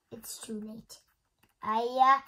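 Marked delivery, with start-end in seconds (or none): 0.1-1.0 soft voiceless whisper. Acted. Gerald warning Piggy, dramatic whisper. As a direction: whisper it like a doomed hero.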